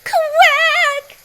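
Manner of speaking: very high, loud quack; squeaky duck quack. A narrator's reading. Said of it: "said Duck".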